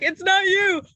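mock wailing shout. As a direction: wail it like a fake breakdown. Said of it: "I, I'm like".

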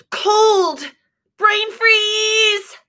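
high, pained cry of cold; mock brain-freeze yelp. Lulu with an ice cream cone on her head, high yelp.

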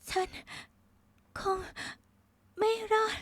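gasping, high-pitched broken words; dying, one gasped word at a time. A narrator's reading. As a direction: force out each word with a failing breath. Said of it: "น้ำเสียงของเรือเอกหญิงขาดเป็นห่วงๆ".

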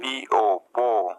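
পিও spelled out, then পো as one syllable; broken into syllables. Shown as a demonstration. Teacher showing the same method, পিও spelled then পো sounded out. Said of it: "একইভাবে দেখুন".

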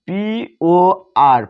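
পিওআর spelled out letter by letter, very slowly; slow spelling. Shown as a demonstration. Teacher to students spelling a word, slow and deliberate. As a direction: stretch each letter of পিওআর for the class. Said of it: "পর".

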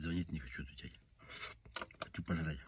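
normal talking voice

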